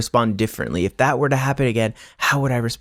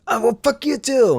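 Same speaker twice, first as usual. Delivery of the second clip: much higher, snippy comeback in a put-on voice; mock snapping back; 12 semitones higher than usual. Answering a rude person in kind, mock snippy retort. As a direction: fire it back, mocking the pettiness. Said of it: "It's like".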